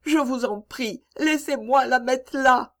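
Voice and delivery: put-on high, pleading child's voice, slowing on the flower; a little girl begging to stay.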